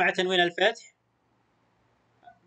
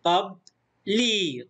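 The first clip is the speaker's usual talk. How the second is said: slow قبلي as "qab ... lii"; split into syllables; much slower than usual. Shown as a demonstration. Teacher sounds out the word for pupils, slowly split. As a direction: pause in قبلي after "qab", then long "ii". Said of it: "مقطع ساكن وهنا مد بالياء".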